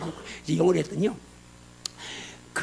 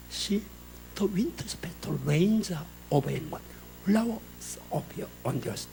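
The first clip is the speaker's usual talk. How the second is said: slow, soft English recitation of love poetry; scripture recitation; 3 semitones lower, 9 dB quieter, much slower than usual. Shown as a demonstration. Pastor showing the congregation Song of Solomon in English, slow soft recitation. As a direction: recite it hushed and unhurried, sinking low at line ends.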